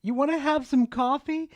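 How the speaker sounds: mock flirty lady calling Cookie in; luring woman. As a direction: coax him inside like a temptress. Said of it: "I heard her say".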